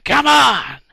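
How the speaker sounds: mock Moses rallying cry; cheering shout. Moses cheering instead of using his powerful stick, loud high yell. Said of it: "standing there going".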